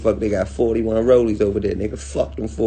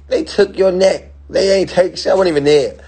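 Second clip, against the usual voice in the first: mocking outrage; 8 semitones higher, 4 dB louder than usual. Real, not acted. Mocking others who brag about robbery.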